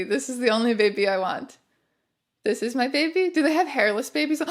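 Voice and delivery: usual speaking voice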